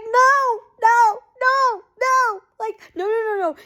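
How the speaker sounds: high, desperate repeated no; a retold frantic plea; pleading no. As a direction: beg him not to go, high-pitched. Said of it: "And she's like".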